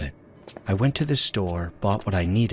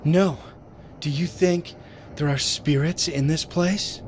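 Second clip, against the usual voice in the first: higher, frightened question; scared voice; 10 semitones higher than usual. Acted. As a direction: shaken and uneasy.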